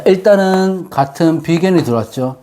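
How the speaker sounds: ordinary talk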